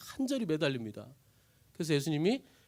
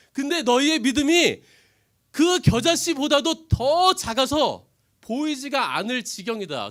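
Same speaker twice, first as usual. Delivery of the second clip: loud, very slow scolding; stern rebuke; 8 semitones higher, 9 dB louder, slower than usual. Acted. Jesus scolding the disciples, slow loud rebuke. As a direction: hammer home how small.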